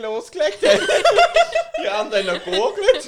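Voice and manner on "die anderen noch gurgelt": lachend. Real, not acted. Alle lachen über scharfes Mundwasser.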